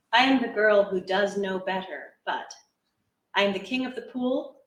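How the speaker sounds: ordinary talk, nothing marked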